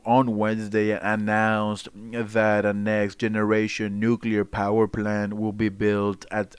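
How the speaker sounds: mock news anchor reading the headline; news reader voice. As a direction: read it like a half-serious anchor. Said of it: "Oh, let me put on the voice".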